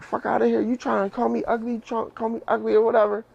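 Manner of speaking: high-pitched mock outrage; imitating angry cousin. Cousin chasing him down the street, high mock-angry voice. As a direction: play the cousin yelling at him.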